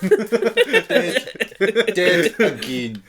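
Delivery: slow, badly faked Russian accent; half-laughing stab at Russian; attempted Russian accent. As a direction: drag it out in a dodgy Russian accent. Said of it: "In Russia, sigh, dead again".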